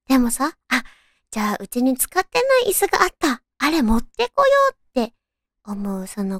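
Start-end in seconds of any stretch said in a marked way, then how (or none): 1.3-4.7 higher, fast, eager idea; eager inner voice. Retold or imitated. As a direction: act out a bright sudden idea. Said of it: "って思うその行動".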